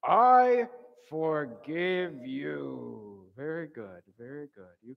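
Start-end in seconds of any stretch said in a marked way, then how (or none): 0.0-3.3 loud, very drawn-out confident declaration; confident, stretched-out group call. Shown as a demonstration. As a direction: lead the room in saying it boldly and slowly. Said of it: "in a confident voice".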